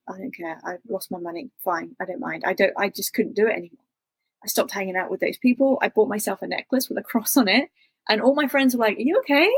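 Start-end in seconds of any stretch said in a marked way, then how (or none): 0.1-2.4 low, soft shrug of a quote; quietly resigned, lower and softer; retold dismissiveness. Shrugging off lost money, retold. 9.0-9.5 higher, rising check-in; mildly mocked concern, pitched up; imitated concerned friends. Friends' question to her, retold.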